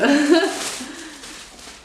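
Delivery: high, real burst of laughter; delighted laugh. Real, not acted. Fan seeing the T-shirt she ordered, delighted laugh.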